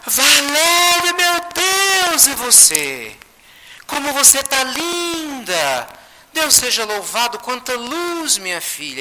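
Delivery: gasp, then high, drawn-out cries of wonder; astonished recognition. Retold or imitated. Chico recognizing Valéria at last, astonished recognition. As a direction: exclaim in amazed joy, settling toward the end.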